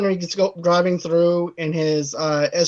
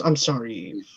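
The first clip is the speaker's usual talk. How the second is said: low, hushed movie-quote apology; quiet, low-voiced excuse; soft apology; 6 semitones lower, 6 dB quieter than usual. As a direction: drop down low and soft, imitating a husband's apology. Voices Castor apologizing to wife.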